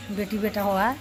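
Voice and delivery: ordinary talk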